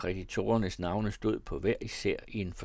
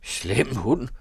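put-on light German accent on Danish words; a tailor speaking Danish with a hint of German; a touch of German accent, nervous at the dog; 4 semitones higher, 5 dB louder than usual. A narrator's reading. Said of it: "talte herr G med sin tyske dialekt".

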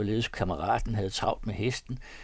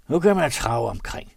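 offended growl, louder; grumbling, offended; 4 semitones higher, 9 dB louder than usual. A narrator's reading. The thief grumbles while trudging through the ravine, offended growl. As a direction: complain with a sulky snarl. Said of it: "knurrende og fornærmet".

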